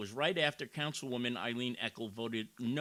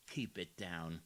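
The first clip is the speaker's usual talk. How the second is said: lower, softer hushed take; mock hush; 7 semitones lower, 8 dB quieter than usual. Commentator quietly mocking the councilwoman's hush. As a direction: drop to a mocking murmur. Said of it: "you talk a little lower".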